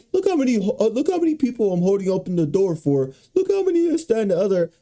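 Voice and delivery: high, smug showing-off voice; mock a clout-chasing TikToker bragging. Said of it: "why should I record and be like".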